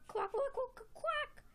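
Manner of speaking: high, rapid duck quacks; quacking pet. Pretend. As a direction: quack along in a squeaky little voice. Quacky joins in the song.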